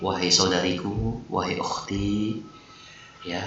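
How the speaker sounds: teacher showing a sweet, soft way to advise; gentle request. Shown as a demonstration. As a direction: ask your sister kindly, softly. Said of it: "Baik-baik caranya".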